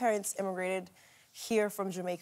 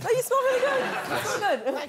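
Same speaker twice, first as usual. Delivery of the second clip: excited; 7 dB louder, faster than usual. Real, not acted. Guest reacts with sudden delight.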